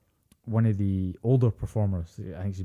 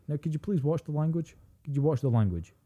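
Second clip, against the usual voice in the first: prissy mock politeness; 6 semitones higher than usual. [name] mocking politeness about swearing.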